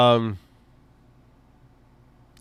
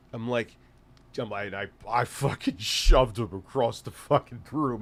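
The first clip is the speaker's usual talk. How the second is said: usual speaking voice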